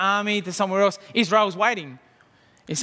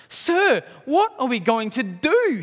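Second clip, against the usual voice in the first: high, loud frightened cry; panicked servant; 7 semitones higher, 4 dB louder than usual. Acted. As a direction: cry out in alarm to his master. Servant sees troops surrounding town.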